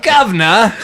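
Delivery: high, loud, hammed-up Cockney British accent; mock British accent. Joking about a crude British reading voice, mock British accent. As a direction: toss it off in a jokey rough British accent. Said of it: "that seems like dirty British".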